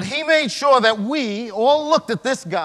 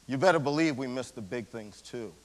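ordinary delivery

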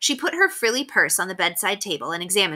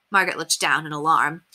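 usual speaking voice

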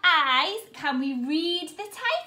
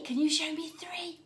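ordinary delivery

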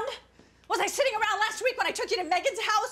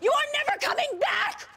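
angry yell; 7 semitones higher than usual. Acted. Mother yells after leaving daughter.

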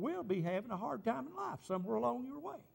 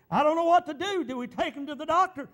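rising high, louder alarmed plea; worried wife; 9 semitones higher, 8 dB louder than usual. Retold or imitated. Wife phones her nurse cousin, alarmed rising plea. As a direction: sound like a scared wife asking for help.

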